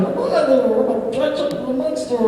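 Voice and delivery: high cartoon monster voice; little chicken monster. Actor being stupid for cast and crew, high chicken monster. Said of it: "little chicken monster person I was doing".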